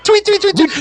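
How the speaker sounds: high chirpy tweet tweet; bird tweeting. Retold or imitated. Imitating a bird sound.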